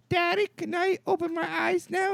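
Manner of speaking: put-on high-pitched little-kid voice; six-year-old kid. Six-year-old asking dad in the theater, high imitated kid voice. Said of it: "the little six-year-old going".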